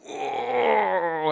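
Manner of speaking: put-on high, loud, awed "Whoa" of a four-year-old; a child stunned at the double ear piercing; little girl's whoa. Preacher imitating his awed daughter. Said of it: "She's like".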